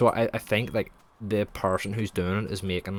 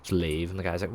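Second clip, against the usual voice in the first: normal talking voice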